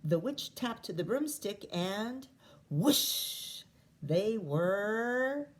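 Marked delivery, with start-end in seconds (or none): 2.7-3.6 whoosh. A narrator's reading. Broomstick taking off.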